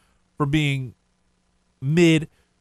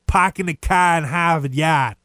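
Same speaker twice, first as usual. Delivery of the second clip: thick Boston accent; 3 dB louder than usual. Shown as a demonstration. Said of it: "Boston accent".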